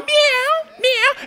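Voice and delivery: very high imitated cat cry; kitty meow. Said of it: "looking at you with them sweet eyes going".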